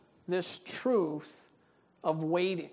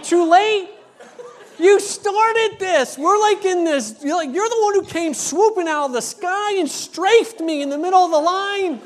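much higher and louder, laughing through it; amused comeback; 11 semitones higher, 11 dB louder than usual. Retold or imitated. Pastor firing back at the woman, high and laughing. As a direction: retort with a giddy, laughing push. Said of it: "I'm like".

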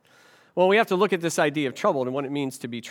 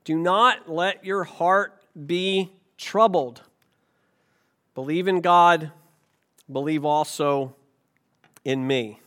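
slow, reverent reading of Jesus' words; scripture reading; slower than usual. Acted. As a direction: read it slowly, like a solemn text. Reads Bible passage aloud.

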